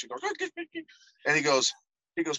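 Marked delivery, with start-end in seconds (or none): 0.2-0.8 a sudden high yelping chirp; imitated chirp. Imitating his friend's Tourette's tic, high chirp. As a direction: chirp out like a nervous tic, much higher. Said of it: "he always do this chirp thing".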